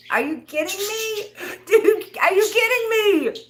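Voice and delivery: high, loud, laughing outrage; a laughing, squealing are-you-kidding-me; laughing disbelief. Real, not acted.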